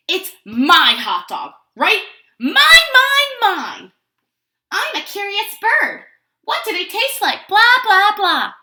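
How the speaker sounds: loud, high taunting mimicry; mocking. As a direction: make fun of the duckling. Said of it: "Pigeon's making fun".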